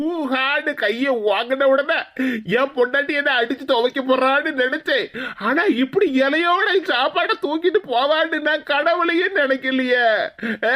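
exaggerated sobbing complaint; tearful wailing. Man lamenting wife ran off with food, bawling. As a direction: stretch the words into a comic bawl. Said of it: "கண்ணீரை வடிச்சு கதறிட்டு கிடக்கா".

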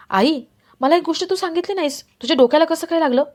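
mildly alarmed son probing for answers; concerned questioning. Acted. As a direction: ask with worried insistence, pitch lifted. Son questions mother about injury.

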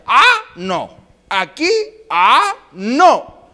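high questioning grunts between noes; mime-talk like a confused drunk; drawn-out rising drunken "ah"; drunk making signs. Acted. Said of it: "los borrachos, puras señas".

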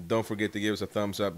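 usual speaking voice

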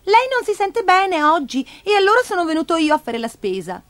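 put-on high-pitched boy character; little boy, explaining. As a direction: explain the errand in a child's high voice.

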